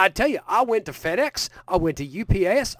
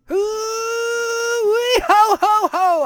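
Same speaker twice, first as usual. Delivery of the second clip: booming high Santa laugh, much higher and louder; 14 semitones higher, 6 dB louder, much slower than usual. Acted. Santa booming hello to podcast host Brandon. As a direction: belt out a jolly Southern Santa greeting. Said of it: "It's me, Southern Santa Claus".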